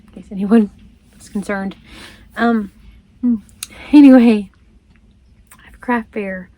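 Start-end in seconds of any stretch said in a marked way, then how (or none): 3.9-4.5 a loud, firm anyway to change the subject; exasperated reset, louder; emphatic loud anyway. Real, not acted. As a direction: snap back on track with a loud anyway. Forceful reset after rambling.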